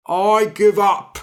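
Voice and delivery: loud, high giant groan; exhausted giant. A narrator's reading. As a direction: give up like a worn-out giant.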